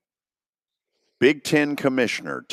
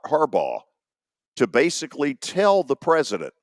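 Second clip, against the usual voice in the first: ordinary talk, nothing marked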